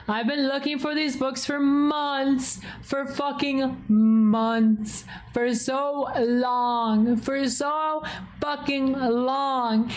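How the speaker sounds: high, drawn-out mock wail; retold upset. Streamer retells the long search for books, upset. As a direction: lament it slowly, stretching each complaint. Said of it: "I was so upset. I was like".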